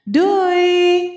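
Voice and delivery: doei called out high and bright; Doei! said high and cheerful; cheery goodbye. Shown as a demonstration. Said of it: "parang ganon".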